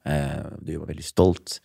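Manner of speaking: soft, hushed words of a dying man, lightly voiced; a hint of a frail uncle speaking quietly. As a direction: murmur it gently like a weak uncle in bed. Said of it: "så sier han til meg".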